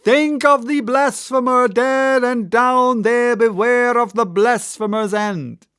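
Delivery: high, loud prophetic warning; vehement exclamation. A narrator's reading. Gabriel warning Ahab of his doom, high and loud. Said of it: "vehemently exclaimed".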